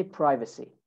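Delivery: American "privacy", first syllable as "pry"; American accent. Shown as a demonstration. Teacher models American "privacy" for students, long first vowel. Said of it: "In American English".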